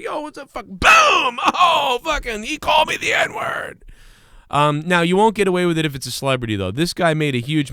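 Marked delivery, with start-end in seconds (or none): 0.0-0.5 mock casual greeting. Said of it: "Just be like". 0.5-3.8 much higher, shouted outburst; hammed-up screaming accusation; mock shout. Acted attack and accusation.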